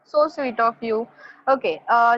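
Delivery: usual speaking voice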